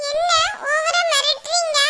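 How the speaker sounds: put-on slow, high childlike complaint; sulky little girl. Girl protesting the aunt's scolding, slow pouting child voice. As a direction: whine back at the aunt like a small girl.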